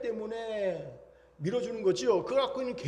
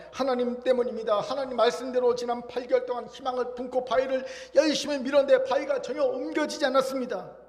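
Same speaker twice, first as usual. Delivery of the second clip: high, raised voice of complaint; aggrieved protest; 4 semitones higher, 4 dB louder than usual. Acted. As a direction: plead your case loudly to God. Man protests to God.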